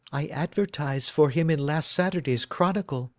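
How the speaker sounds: a worried bride telling her story; anxious young woman. Acted.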